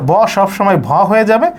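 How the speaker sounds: usual speaking voice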